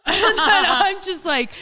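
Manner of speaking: high, loud laugh through the words. Real, not acted. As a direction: burst out laughing mid-sentence. Laughing while retelling.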